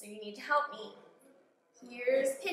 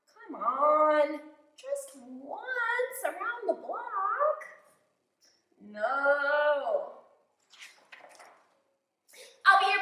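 high, louder pigeon wheedling; beg, bargain, then sulk; pleading pigeon; 5 semitones higher, 12 dB louder, slower than usual. Acted. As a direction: pigeon trying every trick to drive.

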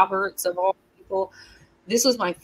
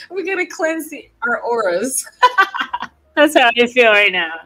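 laughing; 5 semitones higher, 6 dB louder than usual. Real, not acted. Laughing through Housewives bit.

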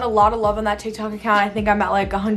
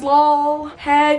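ordinary talk, nothing marked

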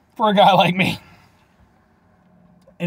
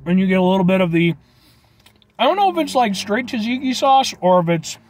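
chewing while talking; talking with food in mouth; mouth full; 2 semitones higher than usual. Real, not acted. Seen 1: Bite at -7; closed, working mouth between words while talking.

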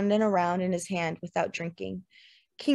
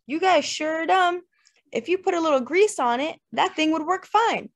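high-pitched dim know-it-all; joke's Norwegian; 9 semitones higher, 3 dB louder than usual. A narrator's reading. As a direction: deliver the punchline as the foolish Norwegian. Norwegian's punchline in Gordy's joke.

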